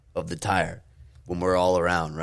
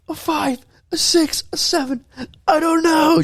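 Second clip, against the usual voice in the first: high, strained mock voice in pain; pained rating; 4 dB louder than usual. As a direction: answer like a kid hurting and rolling around. Hurt kid rating pain.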